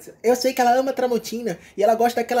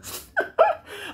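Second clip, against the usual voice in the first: squealing giggle; high laugh; 11 semitones higher than usual. Real, not acted. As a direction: burst of high-pitched laughter. Laugh at own retelling.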